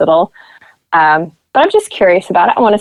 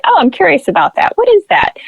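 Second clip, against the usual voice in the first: curious excitement; 11 semitones higher, faster than usual. Retold or imitated. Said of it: "It's like".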